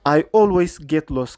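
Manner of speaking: very slow, each word stretched; slow modelled quote. Shown as a demonstration. Teacher models the clip quote for students, very slow. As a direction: lay it out slowly for learners. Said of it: "ከዓኒ ቀጻሊ መገዲ ዝጠፍኣኒ".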